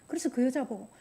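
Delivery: ordinary delivery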